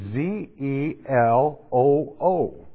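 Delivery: letters spelled out slowly one by one; slow spelling. Shown as a demonstration. Preacher spelling the Greek word slowly for listeners. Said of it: "I'll spell it in English".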